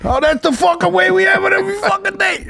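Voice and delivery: high, loud hammed-up Italian yell; mock furious shout. Son yelling back at the dad, hammed-up shouting. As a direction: lose your mind at the complaint. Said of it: "they just lose their mind".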